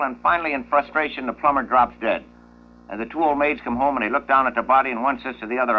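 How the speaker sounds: ordinary talk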